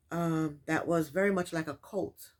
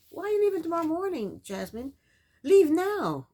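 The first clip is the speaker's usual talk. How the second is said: exasperated scolding; 9 semitones higher, 6 dB louder than usual. Real, not acted. Scolding Jasmine on screen.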